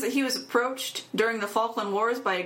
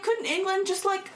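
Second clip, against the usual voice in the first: raised pitch, drawn-out sarcastic question; high mock plea; 9 semitones higher than usual. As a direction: plead it high and slow. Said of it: "I'm like".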